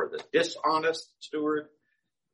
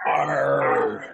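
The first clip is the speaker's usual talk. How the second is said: a louder pirate "Arr" growl; 4 dB louder than usual. Acted. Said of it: "I'll be speaking this entire sermon in pirate".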